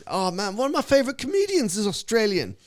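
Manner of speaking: high, loud London lad; gushing mock London voice; London accent. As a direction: imitate an eager London fan. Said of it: "these London dudes".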